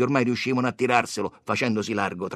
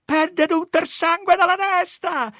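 very high, loud alarm; full-throated scream; 6 dB louder than usual. A narrator's reading. Woman seeing blood on the boy, high loud screaming. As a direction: shriek it out to the crowd. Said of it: "gridò una a tutta gola".

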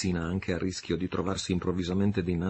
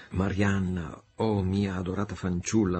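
plain reading voice

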